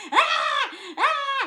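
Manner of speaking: loud, high startled scream; retold scream. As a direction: shriek like a friend hit by flying teeth. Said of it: "she's like".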